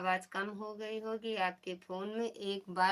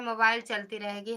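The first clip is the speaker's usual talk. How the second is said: usual speaking voice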